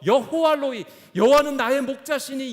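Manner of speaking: high, loud preacher's proclamation; fervent confession. Acted. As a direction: cry it out as David's declaration of faith. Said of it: "이렇게 고백하면서".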